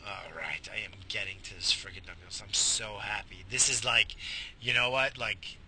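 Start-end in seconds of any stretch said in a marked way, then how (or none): 0.1-2.4 whisper. Real, not acted.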